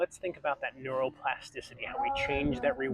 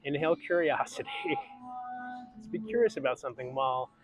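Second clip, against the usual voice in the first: guided breathing; 3 semitones higher than usual. Acted. Leads a breathing exercise.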